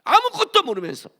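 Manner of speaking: high, loud pulpit declamation; fiery preaching. Acted. Preacher scolding the congregation for complaining, fiery loud preaching. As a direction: drive the point home to the crowd.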